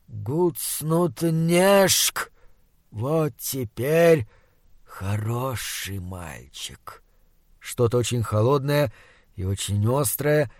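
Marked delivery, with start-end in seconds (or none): 0.1-7.0 slow, hushed, rotting monster voice. Acted. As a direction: drag each word like a dying beast. Monster speaking slowly.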